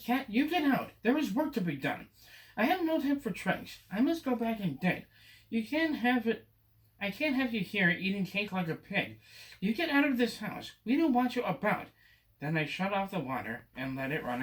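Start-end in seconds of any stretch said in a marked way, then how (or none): 0.0-11.9 put-on boy scolds the cat, get out; angry scolding. Boy orders the Cat out of the house, angrily. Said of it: "And then I got mad".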